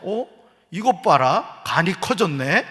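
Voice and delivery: hammed-up scolding of a husband; mocking angry wife. Preacher acting out a wife who scolds her husband the wrong way, mock angry. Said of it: "이러면 안 된다고".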